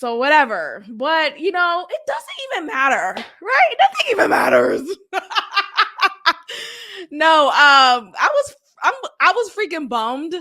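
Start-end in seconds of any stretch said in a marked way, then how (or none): 3.4-4.8 laughing shout. Real, not acted. Laughing exasperated outburst. 4.9-7.1 laughing. Real, not acted. Laughs after outburst.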